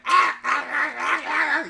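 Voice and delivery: high loud strangled yelps; high yelping dog noise; dog choking yelps. Retold or imitated. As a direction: imitate a choking pit bull. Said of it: "It's, like, going".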